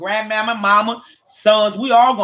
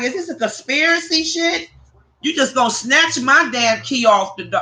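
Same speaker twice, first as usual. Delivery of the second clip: retold disbelief; 5 semitones higher than usual. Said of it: "I'm like".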